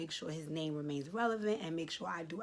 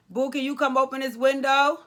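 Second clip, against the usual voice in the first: much higher, much louder call across the house; 9 semitones higher, 16 dB louder than usual. Real, not acted. Calling her helper to come open the window, loud call. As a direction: shout for someone in another room.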